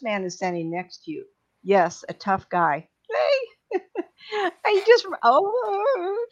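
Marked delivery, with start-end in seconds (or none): 3.1-6.3 excited burst. Real, not acted. Spirit hugs the sitter, she exclaims.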